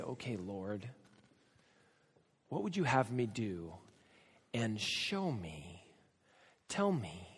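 soft, hushed imitation of someone begging God; mock pleading prayer. Mock prayer asking for a sign.

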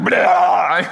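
loud sudden jeering blurt; sudden loud teasing blurt; mock blurt. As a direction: blurt it to catch him off guard. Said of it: "he'd be like".

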